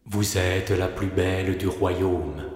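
soft, low, slow mirror voice; hushed, level oracle; magic mirror. Acted. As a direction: answer slowly and softly as an enchanted mirror.

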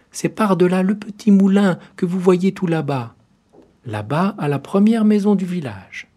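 put-on high, bright child voice pointing far away; a small girl eagerly giving directions; little girl's voice. A narrator's reading. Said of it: "lui dit le petit chaperon rouge".

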